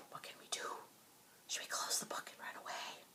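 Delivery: hushed conspiratorial whisper. Pretend. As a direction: whisper as if hiding from the monster. Pretend fear whispering to kids.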